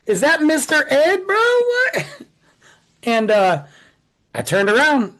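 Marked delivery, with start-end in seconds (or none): 0.1-2.1 mock disbelief. Mocking the Mountie on horseback.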